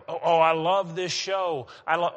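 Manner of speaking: a gushing fan who loves a trashy show. Retold or imitated. Mocking a fan of funny shows.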